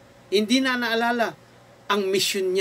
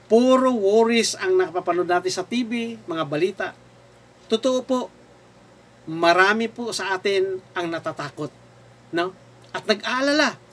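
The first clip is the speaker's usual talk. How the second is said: ordinary delivery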